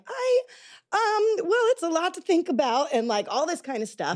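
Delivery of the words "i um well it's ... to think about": high, hesitant stalling reply; deflecting. Retold or imitated. Woman retelling how she dodged a marriage proposal, deflecting. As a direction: imitate yourself hedging in a raised pitch. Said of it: "deflecting and being like".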